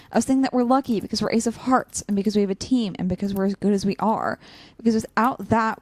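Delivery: ordinary talk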